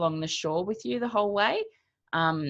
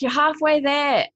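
encouraging call; 10 semitones higher, 7 dB louder than usual. Retold or imitated. Coach calls encouragement mid-swim.

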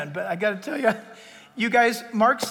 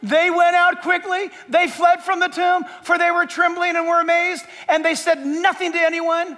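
high, loud preacher's shout; full-force shouted verse reading; shouted proclamation; 8 semitones higher, 9 dB louder than usual. Shown as a demonstration. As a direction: belt out the scripture on a sustained high pitch.

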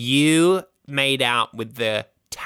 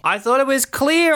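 indignant; 4 dB louder than usual. Acted. Indignant defence.

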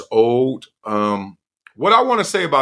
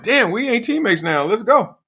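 high hyped challenge; 10 semitones higher than usual. Retold or imitated. Hyped rivalry quoted.

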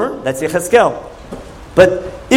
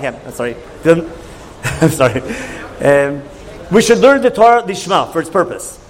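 ordinary talk, nothing marked

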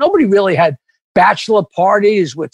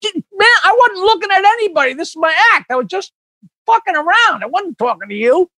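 pitched up, pleading to be let go; scared pleading; 9 semitones higher than usual. Retold or imitated. Pinned over the amp by an angry guest, begging in a high voice. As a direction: plead your innocence in a panic, voice jumping high. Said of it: "I'm like".

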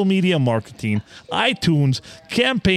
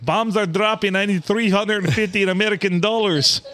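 higher pitched imitated scammer with accent; mock Ukrainian accent; 6 semitones higher than usual. Host imitating a Ukrainian scammer begging for dollars in a put-on accent. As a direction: play the desperate foreign spammer. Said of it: "He's like".

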